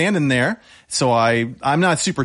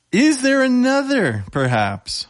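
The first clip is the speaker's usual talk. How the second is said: high, drawn-out question, dropping on "perhaps"; theatrical hinting; 9 semitones higher, slower than usual. Acted. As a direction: stretch it out high, hamming up the suspense. Game character melodramatically hints for another player.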